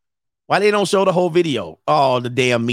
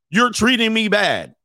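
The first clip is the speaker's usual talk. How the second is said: higher, slow, drawn-out grievance; mock aggrieved complaint; 6 semitones higher than usual. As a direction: imitate a wronged woman complaining. Acts out the pissed-off woman.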